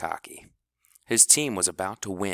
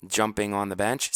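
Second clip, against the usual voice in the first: usual speaking voice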